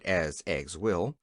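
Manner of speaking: usual speaking voice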